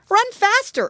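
high, loud urgent piglet; shouted spur to keep running; urging. Acted. Curly urges Flop on in the chase, high urgent shout. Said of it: "urged Curly".

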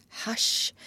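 soft whispered hush; quiet excited children. Acted. Wendy silences the noisy boys.

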